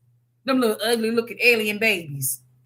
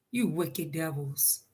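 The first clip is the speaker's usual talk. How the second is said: much softer, slow, lowered voice; low condemnation; 8 semitones lower, 15 dB quieter, slower than usual. Real, not acted. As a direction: let it drop to a slow, disgusted murmur. Condemning slave-insurers after reading news.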